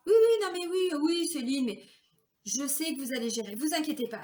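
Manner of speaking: loud, high, breezy brush-off; dismissive boss. Retold or imitated. Boss dismissing the overworked assistant, loud and breezy. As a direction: wave the complaint away cheerfully.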